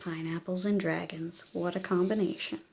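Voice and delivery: soft wry remark; wry aside. A narrator's reading. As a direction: mutter it to the kids as a joke. Narrator's amused aside.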